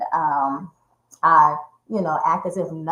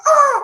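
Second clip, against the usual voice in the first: high, loud cry of pain; pained scream; 9 dB louder than usual. Retold or imitated. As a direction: yelp as the window slams. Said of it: "I was like".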